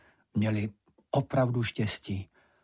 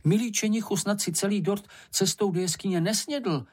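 high-pitched, flustered questioning; exasperated mother demanding an answer; agitated; 8 semitones higher than usual. A narrator's reading. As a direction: press the question, voice raised in pitch. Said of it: "vybuchla maminka rozčileně".

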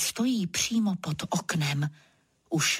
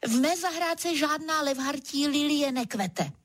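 high-pitched, defiant denial; storyteller's stubborn retort; curt retort; 6 semitones higher than usual. A narrator's reading. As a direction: snap back with mild fierceness. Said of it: "Odseknu a tvářím se sveřepě".

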